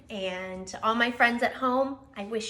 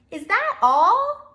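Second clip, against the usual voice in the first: high, loud warm "silly" for a friend; cheerful cry; 13 semitones higher, 10 dB louder than usual. Acted. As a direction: cheer the sad bear up loudly. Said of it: "cried Hedgehog".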